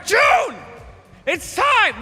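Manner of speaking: high, loud call of the name; excited call. Real, not acted. Host calls the contest winner to the stage, shouting her name.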